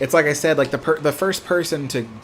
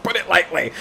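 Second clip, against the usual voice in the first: laughing; 4 semitones higher than usual. Real, not acted. Laughs at his own remark.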